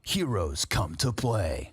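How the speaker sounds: low, slow movie-trailer voice; Pablo Francisco. Acted. Caster delivers mock tagline to co-caster, deep movie voice. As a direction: land the tagline low and drawn out. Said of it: "Pablo Francisco over here".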